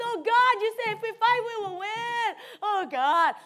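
high, loud pleading cry; begging prayer. Retold or imitated. Pastor on her knees crying out for victory, loud begging. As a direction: beg God at full voice. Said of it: "I'm begging".

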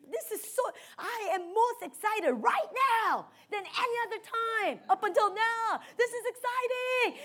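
high, bright-eyed excitement; excited. Retold or imitated. Pastor telling a frightened team member she is thrilled, excited. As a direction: sell the thrill of the fight.

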